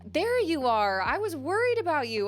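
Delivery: worried dad. Retold or imitated. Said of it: "And my dad was like".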